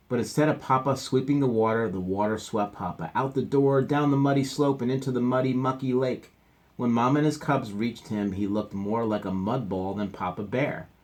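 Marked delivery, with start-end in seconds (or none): none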